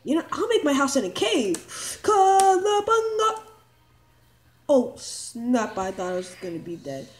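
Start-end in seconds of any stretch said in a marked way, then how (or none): 2.0-3.4 cowabunga cry. Pretend. Kid jumps into cave.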